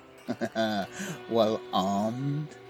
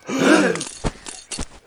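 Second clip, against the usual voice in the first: sharp high gasp; 6 dB louder than usual. Acted. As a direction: gasp in surprise. Gasp at the draw.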